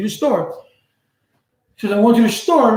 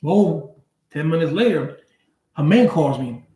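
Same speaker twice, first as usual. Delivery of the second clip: normal talking voice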